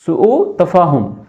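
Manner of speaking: سوء تفاہم said slowly, each syllable spelled out; slow clear model word. Shown as a demonstration. Teacher repeating سوء تفاہم slowly for the class. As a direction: drill سوء تفاہم slowly and distinctly for students. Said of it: "سوء تفاہم یعنی کہ بدمزگی".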